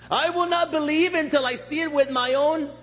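high-pitched, insistent skeptic; stubborn doubter. Acted. Preacher voices the intellect refusing faith, insistent doubter.

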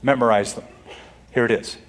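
ordinary delivery

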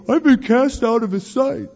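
high-pitched mock wail, put-on voice; mocking complaint. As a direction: throw his complaint back at him in a high, hammed-up voice. Mocking Jonah's complaint back at him.

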